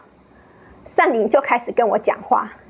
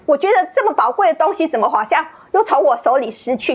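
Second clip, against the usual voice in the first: ordinary delivery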